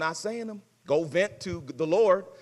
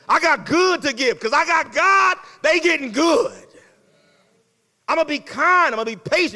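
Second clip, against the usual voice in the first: louder, higher self-pep talk; hyped-up mirror pep talk; 5 semitones higher, 4 dB louder than usual. Retold or imitated. Believer hyping himself up in the mirror each morning, excited. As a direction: psych yourself up in the mirror. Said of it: "get into the mirror and say".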